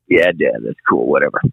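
low, dismissive mumble; imitating an uninterested teenage son; bored teen. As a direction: shrug it off like a bored kid. Teen son brushing off dad.

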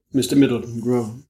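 ordinary delivery, nothing marked

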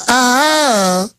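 high, drawn-out mock Georgia "ah"; affected Georgia accent. Host mimicking the official he ridicules, affected Georgia accent. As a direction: stretch it in a fake Georgia accent. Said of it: "This affected fake Georgia accent".

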